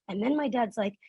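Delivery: usual speaking voice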